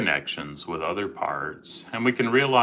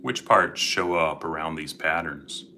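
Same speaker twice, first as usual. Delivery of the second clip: guided meditation; 7 dB quieter than usual. Acted. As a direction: gently guide listeners into calm.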